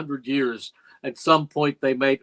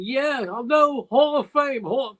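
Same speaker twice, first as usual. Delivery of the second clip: choked-up, much higher voice, reliving the tears; retold crying; 11 semitones higher than usual. Telling wife Cindy on the phone about the Hall of Fame, tearful and much higher. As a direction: blurt it out high as if crying again. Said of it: "And I'm crying".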